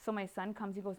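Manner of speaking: usual speaking voice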